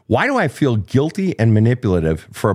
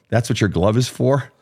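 ordinary talk, nothing marked

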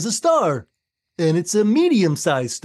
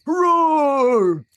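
bear roar; 7 semitones higher than usual. Acted. Bear roars in story.

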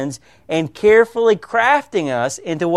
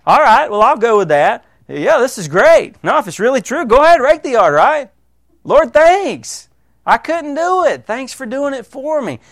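higher, louder mock-eager believer; eager acceptance; 7 semitones higher, 4 dB louder than usual. Believers accepting God's free work, eagerly grateful. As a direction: act out someone gladly saying yes. Acts out people gladly accepting grace.

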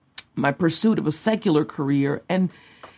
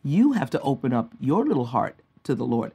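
soft, lower voice of a gentle mother; sweet, motherly; 3 semitones lower, 5 dB quieter than usual. Retold or imitated. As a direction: tenderly set your child straight, softly. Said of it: "very sweetly said to me".

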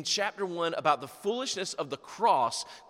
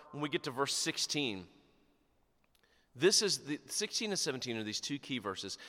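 ordinary delivery

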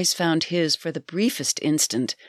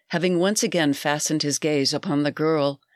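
ordinary delivery, nothing marked

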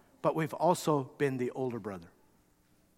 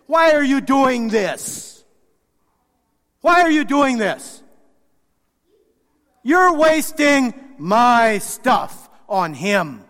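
loud, high, slow accusing questions; demanding; 7 semitones higher, 10 dB louder, much slower than usual. Acted. Older son confronting his father, slow demanding outrage. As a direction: hammer each why at his father. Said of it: "with this kind of demandingness".

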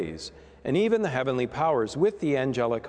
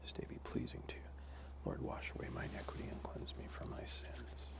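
hushed prayer whisper; 5 semitones lower, 18 dB quieter, faster than usual. Real, not acted. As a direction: breathe it out, barely voiced. Priest's quiet offertory prayer.

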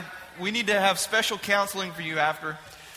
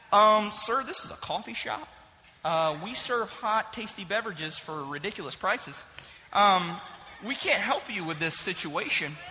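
quieter, polite customer-service voice; barista, soft and unbothered; 2 semitones lower, 7 dB quieter than usual. Acted. Barista telling the panicked caller, politely and quietly, it's a coffee shop. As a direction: talk him down like a bored clerk. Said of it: "barista replies".

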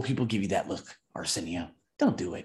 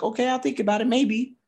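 high, lightly voiced hesitant woman; coy brush-off; 11 semitones higher than usual. Lisa Nichols answering a bar guy, high and coy. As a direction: shrug him off politely. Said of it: "She's like".

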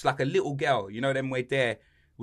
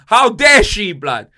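much louder, higher outrage; indignant outburst; 6 semitones higher, 13 dB louder, much slower than usual. Real, not acted. Outraged at the schoolgirl who mocked him, loud and indignant. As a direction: blow up in mock-offended fury. Fuming about being mocked.

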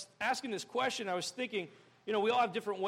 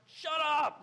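high, sharp silencing shout; fed-up outburst; 6 semitones higher, 4 dB louder than usual. Retold or imitated. Squad telling the panicker to stop, fed-up outburst. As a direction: cut off the panicker. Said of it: "everyone's just like".

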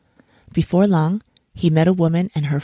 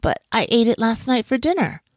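high, dismayed explanation; mildly flustered man; protesting cry; 6 semitones higher than usual. A narrator's reading. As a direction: protest in surprise, pitch raised. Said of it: "cried the man".

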